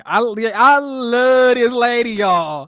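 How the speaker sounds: gushing. Real, not acted. Gushing over a cast member.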